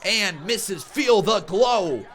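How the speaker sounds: high, loud hyped wrestling commentary; live play-by-play. Acted. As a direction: call the brawl like a ringside announcer.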